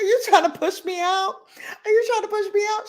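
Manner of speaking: high, mock-offended, second time fast; mock indignant. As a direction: feign outrage at the coworker. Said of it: "I was like".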